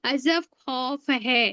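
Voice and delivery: mnemonic line spelled out slowly; dictating a memory line slowly; slow recital. Shown as a demonstration. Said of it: "देखो यही word आ रहे हैं".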